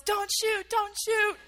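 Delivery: high-pitched panicked plea, retold; retold yell. Friend yelling to soldiers from behind her, high retold yell. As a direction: cry out like a friend begging for lives. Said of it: "she yells".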